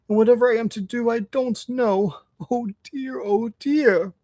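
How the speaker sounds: high-pitched weepy washerwoman act; Toad's hammed-up tearful woe; mock crying. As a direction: plead pitifully in a high put-on voice. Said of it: "crying afresh".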